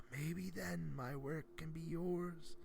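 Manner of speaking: put-on soft, slow weary old man; tired old man. A narrator's reading. Old man offering the boy his work, tired and soft. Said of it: "he said tiredly".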